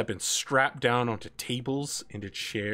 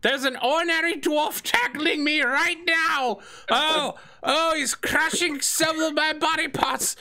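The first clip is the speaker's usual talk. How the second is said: put-on old man screaming for help; 12 semitones higher than usual. Tackled by the dwarf, the old assistant yells for help. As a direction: wail like he's being crushed.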